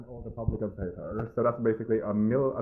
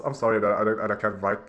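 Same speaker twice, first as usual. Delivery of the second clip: usual speaking voice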